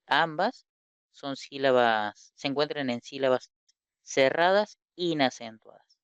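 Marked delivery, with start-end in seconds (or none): none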